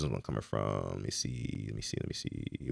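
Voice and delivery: low, very soft mumble while searching; absent-minded low mutter; muttering to oneself. Real, not acted. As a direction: drop right down and murmur it, as if scanning a list. Searching chat for donor's name.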